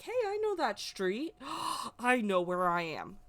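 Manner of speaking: high-pitched excited realisation with a gasp; relieved recognition. Retold or imitated. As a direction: re-enact suddenly spotting a familiar street, gasp mid-way. Retold relief at recognising the street.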